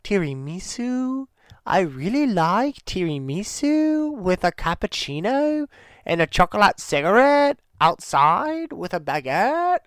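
pretentious ponce. Shown as a demonstration. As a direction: lift it up high and linger like a pretentious ponce ordering dessert. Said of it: "I said that like a Ponce".

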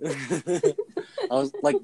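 high, laughing voice. Real, not acted. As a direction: still cracking up. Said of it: "that was making me laugh".

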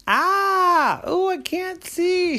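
put-on high, loud cry of a blinded girl; dazzled cry. Blinded girl cries out at the light, high and slow. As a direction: shield your eyes and cry out high and slow. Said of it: "she said".

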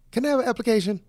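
high, put-on polite request; lightly hammed-up applicant voice; eager applicant. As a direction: pitch it up like a hopeful job seeker. Acts applicant asking for a job.

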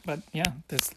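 ordinary talk

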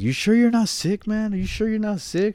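high-pitched mimicked questioning; concerned people, imitated. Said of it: "a lot of people were telling me".

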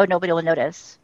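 low mock aside, lightly snide; passive aggressive. Co-host mimicking a commenter on an old video, passive aggressive. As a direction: drop it low, imitating a sly commenter. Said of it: "talk about passive aggressive".

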